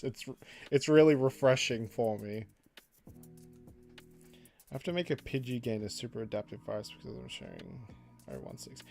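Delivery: ordinary talk, nothing marked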